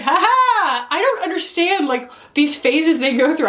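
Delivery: high, chuckling imitation of someone unbothered; amused bystander voice. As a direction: lightly play the relaxed one. Voicing an amused family member.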